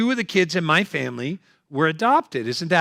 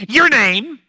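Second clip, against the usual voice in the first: sharp, louder barked question; mock yell; 8 semitones higher, 5 dB louder than usual. Annoyed gate agent snapping at the comedian. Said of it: "just yelled at me".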